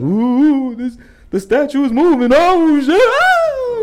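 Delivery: high, loud hammed-up panic; mock scared shriek. Teens scaring each other, shrieking in mock fright. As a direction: shriek like a spooked teen.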